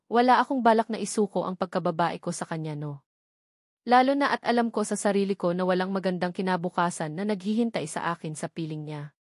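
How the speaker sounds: usual speaking voice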